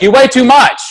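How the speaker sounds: high, loud scolding nurse; mock yell. Nurse scolding the obese patient, high mock yell. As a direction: bark it at him like a strict nurse. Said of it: "she's yelling at me".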